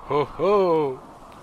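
a bright, high, loud sailor's "oh, oh"; lively exclamation. A narrator's reading. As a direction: jolt up with a keen alert cry. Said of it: "alzando vivamente la testa".

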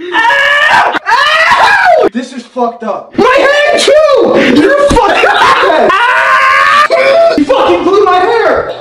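yelling in pain. Real, not acted. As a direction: scream as your hair pulls. Hair glued, pulled out.

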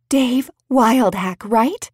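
bright, eager, drawn-out gush; gushing like a groupie. A narrator's reading. Bridget gushing at Dave like a groupie, drawn out. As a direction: gush his name like a starstruck fan. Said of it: "Bridget gushed like a groupie".

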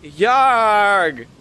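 loud gruff pirate shout; pirate yarg. Shown as a demonstration. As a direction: bellow it like a pirate captain. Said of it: "let me hear your best yarg".

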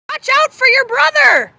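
urgent warning shout. Shown as a demonstration. Teacher modelling an urgent command as an alarmed shout. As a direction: yell it like the danger is right there. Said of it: "if it shows strong emotion".

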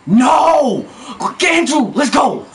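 2 high, loud pained cry; 3 yell in panic; 4 gamer's frantic shout; 1 real frantic shout. Real, not acted. Frantic yelling during play.

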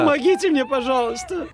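high, pained plea for help; begging through the burn; mouth burning from spicy food. Real, not acted. Pleading in pain from pepper.

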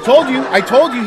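loud, high, laughing; excited shout. Real, not acted. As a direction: shout it out with glee. Excitedly proving his point.

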